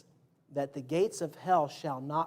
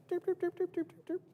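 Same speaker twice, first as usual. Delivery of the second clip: high sing-song doops, getting softer; walking gate noise; 13 semitones higher, 5 dB quieter than usual. Shown as a demonstration. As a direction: chirp the footsteps high and light. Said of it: "a gate that's like".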